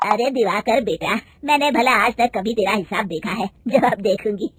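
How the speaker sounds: a hint of a slow elderly mother-in-law; old mother-in-law. Mother-in-law greets manager and calls for tea, slow old voice. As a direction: welcome the guest, slow and loud.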